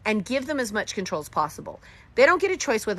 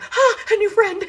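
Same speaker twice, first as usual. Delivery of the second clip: high, louder gushing "ah"; mock delight; 8 dB louder than usual. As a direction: gush like you found a new friend. Homeschoolers greeting each other.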